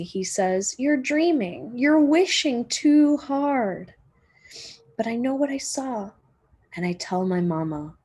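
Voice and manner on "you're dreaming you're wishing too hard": higher, louder, slow teasing brother; doubting big brother. Acted. Brother brushing off Marcy's report, mock-doubting and teasing. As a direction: gently scoff at her story.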